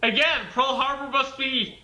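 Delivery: normal talking voice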